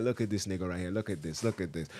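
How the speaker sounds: low, soft gossipy mutter pointing someone out; mocking low mutter. Friends gossiping, sneering low about someone nearby. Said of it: "I'm like".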